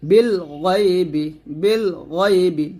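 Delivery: "গইবি" slowly, with a thick throaty gh; heavy ghain. Shown as a demonstration. Teacher repeating for learners, heavy gh in "গইবি". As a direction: thicken the first sound of "গইবি". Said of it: "গয়েনটা আমরা মোটা করব".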